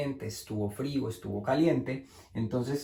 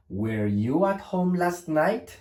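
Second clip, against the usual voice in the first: slow, higher "Were you at home last night" with a rising end; question intonation; 4 semitones higher, slower than usual. Shown as a demonstration. Teacher modelling the correct question structure, rising question intonation. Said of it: "Para que sea pregunta".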